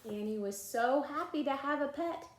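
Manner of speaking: plain reading voice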